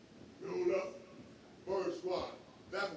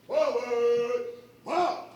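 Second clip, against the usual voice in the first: drawn out parade commands; 4 semitones higher, 8 dB louder, much slower than usual. Real, not acted. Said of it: "drill leader calling commands".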